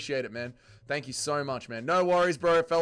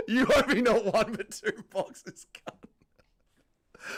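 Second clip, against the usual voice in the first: slow, laughing through it; amused laughter; 7 semitones higher, 4 dB louder, much slower than usual. Real, not acted. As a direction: read it out while cracking up. Reading chat while laughing.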